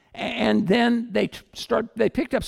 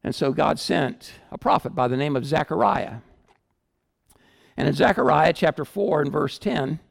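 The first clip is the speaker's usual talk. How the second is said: usual speaking voice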